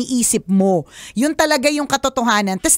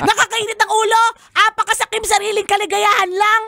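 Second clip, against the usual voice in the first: laughing half-yell, higher pitched; mock yelling; 6 semitones higher than usual. Host half-yells at Carla about her selfishness. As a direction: rant in jest at the caller. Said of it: "sumisigaw ba ako?".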